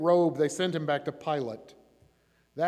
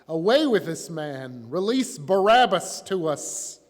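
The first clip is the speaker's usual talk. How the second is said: slow, louder, raised demand; narrated crowd shout; 2 semitones higher, 7 dB louder than usual. A narrator's reading. Angry crowd demands Barabbas from Pilate, shouting. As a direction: voice a crowd's shouted demand, each word drawn out. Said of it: "the whole crowd shouted".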